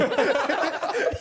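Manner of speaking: high burst of laughter; big laugh. Real, not acted. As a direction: crack up loudly. Laughs at own line.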